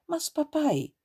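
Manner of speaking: put-on high, pleading little girl; a hint of a daughter protesting; girl's protest. A narrator's reading. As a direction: cut in high to plead with father. Girl protests to angry father.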